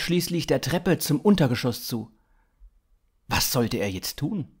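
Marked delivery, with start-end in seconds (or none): none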